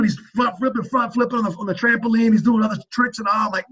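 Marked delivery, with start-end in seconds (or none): none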